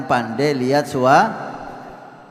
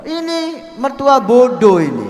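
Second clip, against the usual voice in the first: exasperated scolding; 8 semitones higher, 6 dB louder than usual. Real, not acted. Preacher scolding foolish in-law.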